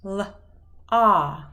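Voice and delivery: L-a said as separate sounds, l then a; two clear sounds, l and a; segmented phonemes. Shown as a demonstration. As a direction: sound it out slowly. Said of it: "let's try the first one together".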